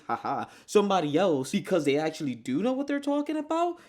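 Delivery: ordinary talk, nothing marked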